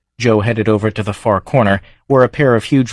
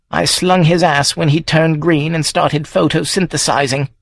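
put-on higher old farm woman's voice; a wry old countrywoman spinning a yarn; old Wendy, scornful; 7 semitones higher than usual. Wendy telling Joe about her green ex-boyfriend, in a higher, wry old woman's voice.

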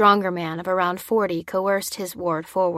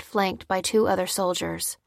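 plain reading voice

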